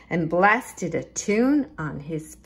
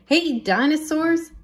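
excited call; 9 semitones higher, 4 dB louder than usual. Acted. Calling dinosaurs to the party.

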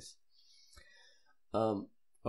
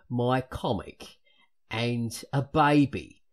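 loud, slow Cockney in a Michael Caine manner; hammed-up Cockney, louder and deliberate; Cockney accent; 2 semitones higher, 8 dB louder than usual. Shown as a demonstration. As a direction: put on a drawn-out, emphatic Cockney. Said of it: "Cockney is what it is".

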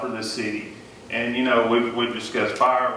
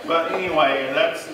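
ordinary delivery

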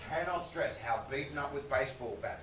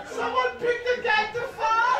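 laughing; 7 dB louder than usual. Real, not acted. Laughing outburst at audience member.

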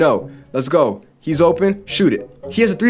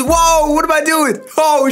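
surprised whoa; 7 semitones higher, slower than usual. Real, not acted. Gamer startled by his player's antics, surprised whoa. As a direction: pitch jumps higher on a startled whoa.

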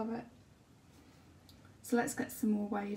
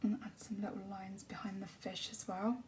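whispered painting commentary; soft ASMR whisper; 9 dB quieter than usual. Acted. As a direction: breathe the words as you paint.

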